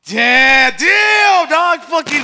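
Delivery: high, loud yelp of shock; loud, high outburst; startled shout. Real, not acted. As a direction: shout it out, caught off guard. Reacts to jab, shouting.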